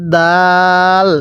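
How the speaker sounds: দাল with one long, level-held vowel; slow recitation-drill দাল; drawn-out letter name. Shown as a demonstration. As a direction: stretch the vowel in দাল steadily. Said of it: "এরকমভাবে পড়তে হবে".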